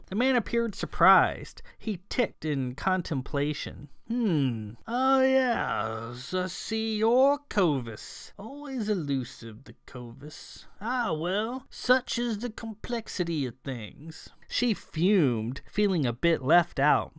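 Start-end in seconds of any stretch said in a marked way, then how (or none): 4.1-14.3 high, airy elfin musing that drops away; musing accented elf. A narrator's reading. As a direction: ponder aloud in a lilting elfin accent.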